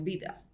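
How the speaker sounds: "vida" said Spanish-style as "bida"; unmarked Spanish B at the start of "vida". Shown as a demonstration. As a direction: say "vida" with a two-lip B sound. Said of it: "no es lo mismo que digas".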